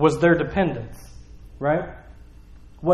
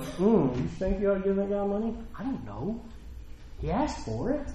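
much softer, doubtful muttered aside; mock skeptic; 14 dB quieter than usual. Friend questioning whether to give money, quietly skeptical. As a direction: imitate a dubious friend under the breath. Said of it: "some people will be in my life at that time like".

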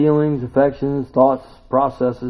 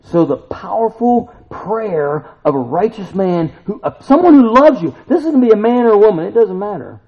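loud, high preaching push; emphatic preaching; 10 semitones higher, 10 dB louder than usual. Real, not acted. Pastor stressing the powerful prayer, loud preaching. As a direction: drive the verse home.

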